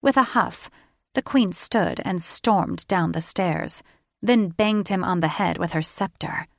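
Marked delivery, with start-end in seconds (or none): none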